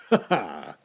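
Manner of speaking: mad little chuckle, then a curt ha ha; gloating laugh. Acted. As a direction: laugh at your own cleverness. Murderer gloats over hidden body.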